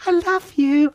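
mock adoring falsetto; very high, hammed-up declaration of love; gushing fan. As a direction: squeak it like a starstruck fan. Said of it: "I didn't actually say, "I love you".

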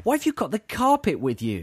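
high, teasing put-on puzzlement; playfully incredulous question; mock bewilderment. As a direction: feign surprise at your girlfriend's predicament. Teasing girlfriend in acted question.

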